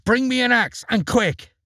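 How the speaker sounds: high, urgent shout for help; a boy yelling with the ogre behind him; Jack's cry. Acted. Jack calling his mother while sliding down, high and urgent. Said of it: "cried Jack".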